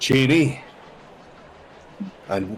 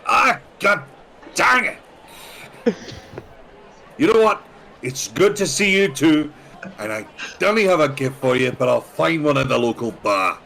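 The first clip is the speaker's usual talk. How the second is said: raised, loud rowdy traveller voice; high loud adventurer; 8 semitones higher, 5 dB louder than usual. Acted. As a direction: bluster in as a rowdy newcomer. Character greets party loudly.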